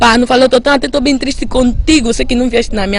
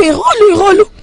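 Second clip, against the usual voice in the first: much higher, getting louder as she repeats; rising excitement; 11 semitones higher, 4 dB louder than usual. Real, not acted. Woman reliving fighting off her attacker, higher and heated.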